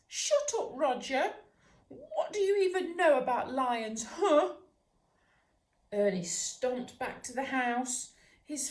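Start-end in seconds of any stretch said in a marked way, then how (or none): 0.1-4.5 much higher, louder hurt puppy yelling; indignant retort. Acted. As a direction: fire back, stung and cross. Embarrassed puppy snaps back at neighbour.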